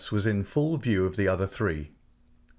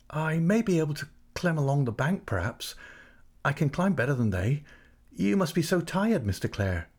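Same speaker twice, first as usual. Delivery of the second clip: lightly voiced young girl, higher, hesitant; a hint of a shy country girl offering to climb; Tess, shy dairymaid; 7 semitones higher than usual. A narrator's reading.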